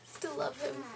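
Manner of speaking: high, acted adoring fan voice; devoted fan's insistence, put on; Kate. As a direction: pitch it up, still smitten. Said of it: "But Kate, however, was like".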